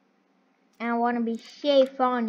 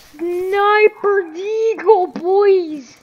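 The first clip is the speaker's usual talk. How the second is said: hyped call; 7 semitones higher, 9 dB louder, much slower than usual. Real, not acted. Hyping a sniper kill.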